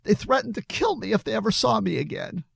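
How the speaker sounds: high, upset tearful confession. A narrator's reading. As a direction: blurt it out high, near tears. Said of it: "A tear rolled down his face".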